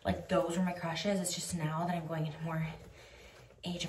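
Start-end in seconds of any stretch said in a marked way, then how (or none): none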